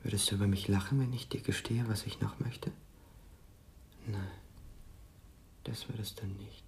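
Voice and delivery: tender confession. Acted. Frank confesses his longing to Mia, tender and hushed. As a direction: confess it shyly, almost silent.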